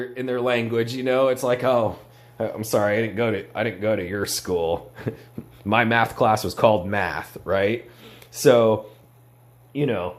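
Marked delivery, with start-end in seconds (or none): 1.6-4.9 a lightly voiced snooty grad, a touch lower; mild mock of a pretentious apologizer; liberal arts twang. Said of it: "liberal arts degree twang".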